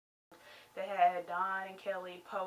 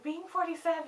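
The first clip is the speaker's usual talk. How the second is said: higher-pitched mock quote; mocking imitation; 7 semitones higher, 4 dB louder than usual. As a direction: mimic her as if it were a grand announcement. Mimicking Dawn's line.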